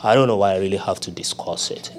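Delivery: low, offhand, indifferent tone; mock a lazy prayer; bored casual prayer. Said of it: "Jesus is not impressed".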